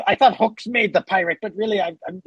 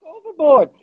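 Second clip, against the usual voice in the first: calling out; 8 semitones higher, 4 dB louder, faster than usual. Acted. Pirate yells warning again.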